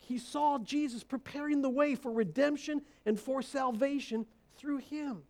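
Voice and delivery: usual speaking voice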